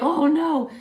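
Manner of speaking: high, loud gasp of alarm. Retold or imitated. Classmates reacting to a girl defying the teacher, alarmed. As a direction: students dreading the clash. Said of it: "We're like".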